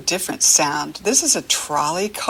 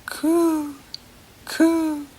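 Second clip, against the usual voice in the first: pigeon coo; 8 semitones higher than usual. Shown as a demonstration. Teacher voicing pigeons for children, high coo. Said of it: "Pigeons say what?".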